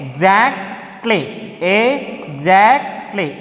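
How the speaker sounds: "exactly" broken into three slow, separate syllables; teacher sounding out "exactly" in pieces. Shown as a demonstration. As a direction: draw out each part of "exactly", with gaps between. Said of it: "In that we have three syllables".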